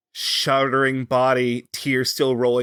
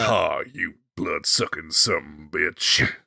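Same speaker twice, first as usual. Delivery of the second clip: mock cowboy; 8 semitones lower than usual. Mock cowboy hunter line.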